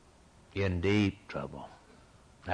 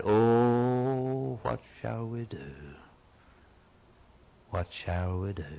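mild anguished cry; slower than usual. Acted. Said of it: "And they cried".

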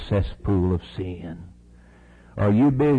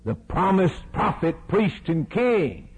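ordinary talk

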